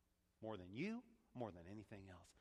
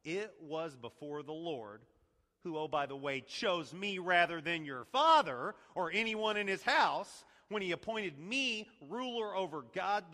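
David's firm reply; 5 semitones higher, 10 dB louder than usual. Retold or imitated. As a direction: put her in her place. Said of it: "Listen to David's response".

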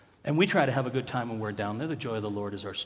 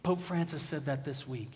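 ordinary talk

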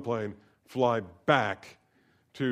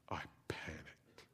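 breathy hushed whisper of panic; 7 semitones lower, 16 dB quieter, slower than usual. Retold or imitated. Pastor retelling his passport scare, whispered. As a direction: whisper it as if the panic is still fresh.

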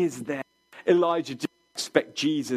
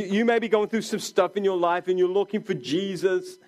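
ordinary talk, nothing marked

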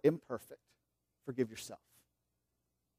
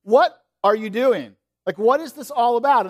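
higher and louder, mock-startled questioning; bewildered what are you doing; 7 semitones higher, 7 dB louder than usual. Monk council startled at the leaking jug, loud mock disbelief. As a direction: throw up the question as if catching someone mid-mess. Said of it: "We go".

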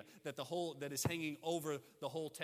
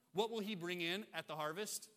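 ordinary talk, nothing marked

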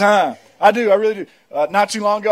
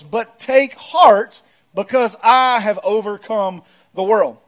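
slow, weighty proclamation; preaching scripture; 4 semitones higher, 6 dB louder, slower than usual. Acted. Preacher reading Jesus' words to church, emphatic proclamation. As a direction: proclaim each phrase with deliberate force and drawn-out words.

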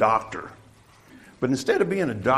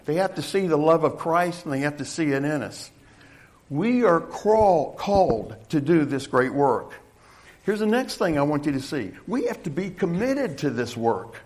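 raised, emphatic pulpit voice, higher pitched; forceful preaching; 7 semitones higher, 5 dB louder than usual. Real, not acted. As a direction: preach it with rising urgency. Preacher pressing home his point.

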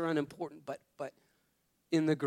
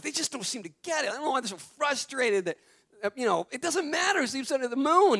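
higher, quicker mock rant; exasperated venting; 8 semitones higher than usual. Husband venting to wife about the kids, higher mock exasperation. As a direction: act out venting to his wife. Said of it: "I'm telling Laura, I'm like".

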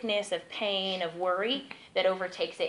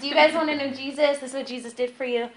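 mock street-preacher pitch, higher; retold preaching call; 6 semitones higher, 4 dB louder than usual. Street evangelist inviting passers-by to know Jesus, higher retold preaching call. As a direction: lift it like calling to a crowd.

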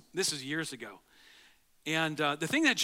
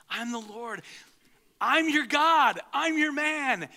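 higher, louder, slow on I'm your God; excited proclamation; 6 semitones higher, 5 dB louder, slower than usual. Acted. Pastor voices God's promise to the congregation, exultant. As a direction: proclaim it triumphantly. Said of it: "that's what God's saying".

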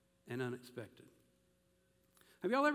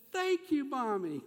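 put-on high-pitched little-girl gratitude; 8 semitones higher, 5 dB louder, slower than usual. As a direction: chirp it like a child thanking mom. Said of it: "that little girl says".

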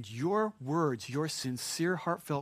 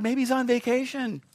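higher mock suggestion, dropping at the end; taunting; 8 semitones higher, 4 dB louder than usual. Elijah jeering at Baal's prophets, higher and mocking. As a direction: tease with a raised voice. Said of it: "Elijah began to taunt them".

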